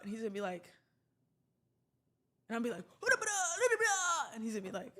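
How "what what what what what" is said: very high, hammed-up babbling; mock excited gabbling. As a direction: babble away in a silly high voice. Imagines herself gabbling at friend again.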